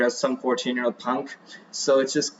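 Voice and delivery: ordinary delivery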